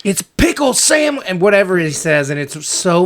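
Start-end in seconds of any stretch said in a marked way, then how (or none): 0.1-1.2 high, exasperated yell; frantic shout. Retold or imitated. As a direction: shout it like you've lost it. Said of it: "he just loses his mind".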